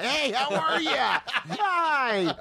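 high, slow, fake-friendly Hollywood greeting; phony nice guy. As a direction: gush with hollow cheer. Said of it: "Hollywood phony nice guy".

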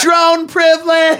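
high, drawn-out mock shout; mock outcry. Comic voicing a student under drone attack, mock outcry. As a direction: stretch it into a hammed-up cry.

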